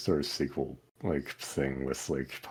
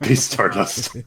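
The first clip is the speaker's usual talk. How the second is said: loud, slow, drawn-out high echo of the pun; gleeful echo; 8 semitones higher, 12 dB louder, slower than usual. Pretend. Friend echoing a pun title, gleeful and loud. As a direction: savour the joke title with delight. Mock repeating a joke title.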